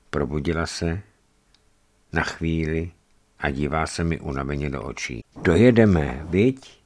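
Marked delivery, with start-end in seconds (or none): none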